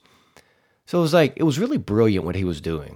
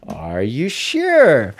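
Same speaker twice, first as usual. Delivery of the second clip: hugely drawn-out, louder teasing question; playful drive-thru clerk; 2 semitones higher, 5 dB louder, much slower than usual. Retold or imitated. Drive-thru guy doubts the customer's final order, slow and teasing. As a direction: stretch it out slowly to needle the customer. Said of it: "just horsing around so much".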